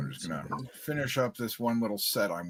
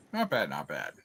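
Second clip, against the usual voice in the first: ordinary talk, nothing marked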